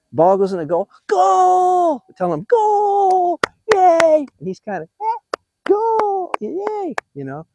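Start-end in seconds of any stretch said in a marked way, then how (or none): 1.1-2.0 high, drawn-out goal cheer; celebrating goal. Acted. Grandpa cheering his grandson's toy soccer goal, high drawn-out celebration. 2.4-4.3 high, drawn-out goal cheer; celebrating goal. Acted. Grandpa cheering his grandson's toy soccer goal, high drawn-out celebration. 5.0-6.9 very high, small-voiced goal cheer; imitated toddler cheer. Grandpa imitating his two-year-old grandson cheering, tiny high voice.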